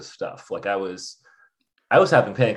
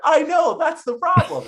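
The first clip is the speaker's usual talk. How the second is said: very high, louder, frustrated outburst; exasperated cry; 3 dB louder than usual. Retold or imitated. To friends who don't get it, high, exasperated outburst. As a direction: throw it up in the air in frustration.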